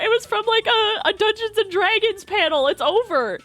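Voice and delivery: high, insistent retold urging; re-enacted eager insistence; imitating the director. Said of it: "He's like".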